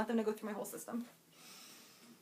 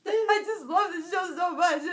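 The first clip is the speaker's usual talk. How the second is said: laughing while gushing; 8 semitones higher, 8 dB louder than usual. Real, not acted. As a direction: giggle through the words. Gushing about the show.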